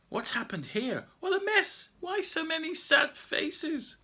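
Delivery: very high, loud astonished; shocked child. Acted. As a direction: gasp at the ruined forest.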